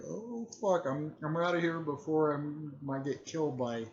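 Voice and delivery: high-pitched mock alarm; imitated panic. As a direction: voice a character desperate to bolt. Character panics and wants to flee.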